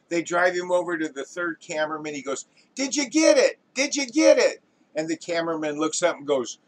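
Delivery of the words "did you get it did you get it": very high, loud, excited questioning; eager director. Acted. Director to third cameraman, excitedly asking. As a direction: pin every hope on the answer.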